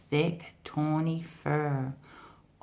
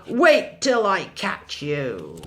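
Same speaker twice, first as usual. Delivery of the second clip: slow, loud growling threat; furious tiger threat; yelled threat; 2 semitones higher, 7 dB louder than usual. Acted. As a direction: shout it after the fleeing deer. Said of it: "he yelled".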